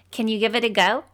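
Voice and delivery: ordinary talk, nothing marked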